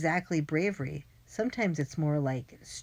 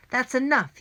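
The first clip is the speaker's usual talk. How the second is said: higher, louder shout; angry yell; 7 semitones higher, 7 dB louder than usual. A narrator's reading. Man chasing the runaway kids, angry yell. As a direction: yell to stop them. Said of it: "he yelled".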